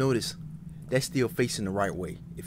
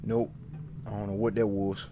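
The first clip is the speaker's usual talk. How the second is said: normal talking voice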